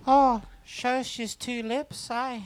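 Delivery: slow, high-pitched stab at Australian; attempted Australian accent. Having a go at Australian after the demo, slow high mock Australian. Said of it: "That's as good as I can do".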